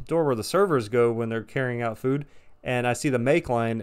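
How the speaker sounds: ordinary delivery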